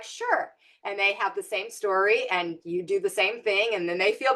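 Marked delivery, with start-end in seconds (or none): 0.0-0.5 a high, strained "Sure."; reluctant sure. Retold or imitated. Reluctantly agreeing to the friend's second call, high and strained. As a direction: agree while dreading it.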